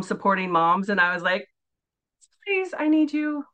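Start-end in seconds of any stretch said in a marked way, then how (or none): 2.4-3.4 pleading. Retold or imitated. Retelling her plea.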